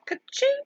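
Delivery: high-pitched "ka-ching"; cash-register imitation. Streamer breaking off from reading chat, high cash-register imitation. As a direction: ring it out high like a till.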